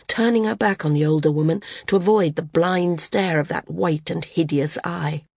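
usual speaking voice

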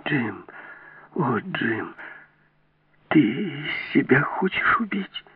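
soft, slow, whispery dread; horrified plea. A narrator's reading. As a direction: breathe it out, aghast for him. Terrified woman pleads at gunpoint.

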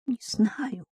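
mumbled. Acted. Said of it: "пробормотала она".

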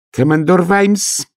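sharp, high, slow call. Acted. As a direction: snap his name out. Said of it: "резко сказал инспектор".

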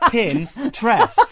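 "Pinterest" pulled apart as Pin-trest; "Pinterest" as pin plus trest, like interest; correct pronunciation. Shown as a demonstration. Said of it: "the site itself is just Pinterest".

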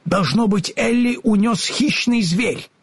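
high-pitched, worried character voice; alarmed Scarecrow. A narrator's reading. Scarecrow guesses the girl was snatched.

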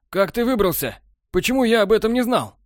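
high, agitated demanding questions; agitated disbelief. A narrator's reading. Borland demanding answers from Technar, high agitated. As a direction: press him, rattled and baffled.

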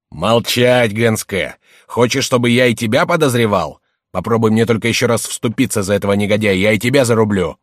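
stern, heated warning; angry threat. Acted. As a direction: cut him off and threaten him. Master silences and threatens second servant.

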